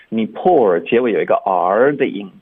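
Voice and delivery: ordinary talk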